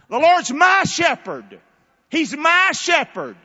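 loud, high preaching, key words stretched; emphatic declaration. Shown as a demonstration. Preacher showing how to talk back to life's stress, emphatic. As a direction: proclaim it boldly, leaning hard on key words. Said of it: "talk like that".

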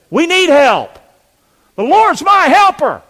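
high, loud cry building to a shout; impassioned cry. Real, not acted. Preacher declares sinners' need for help, impassioned cry.

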